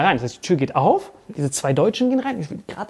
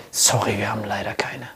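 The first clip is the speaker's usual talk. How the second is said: mimicked whisper; 12 semitones lower, 5 dB quieter than usual. Employee refusing, mimicked.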